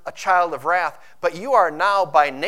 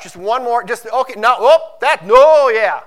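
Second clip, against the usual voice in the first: hammed-up inner tug-of-war; 5 semitones higher, 11 dB louder than usual. Acting out craving inner struggle.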